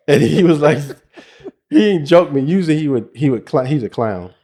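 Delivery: loud, laughing retelling. Real, not acted. Father laughing as he tells about his son. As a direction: laugh through the words.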